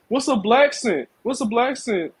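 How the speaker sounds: imitating people who act clueless; mock clueless question. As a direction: play dumb, pitching the question up. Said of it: "I hate when people say".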